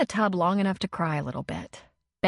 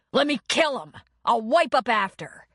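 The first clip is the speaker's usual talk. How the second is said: higher, louder menacing threat; furious threat; 5 semitones higher, 4 dB louder than usual. A narrator's reading. Man held back wanting to fight, furious threat. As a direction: strain against the arms holding you.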